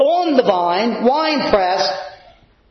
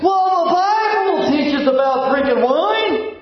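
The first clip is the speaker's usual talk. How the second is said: higher-pitched mimicry of excuse-making Christians; mock excuse; 7 semitones higher than usual. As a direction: parrot a feeble excuse in a raised, put-on voice. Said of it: "Christians use this excuse".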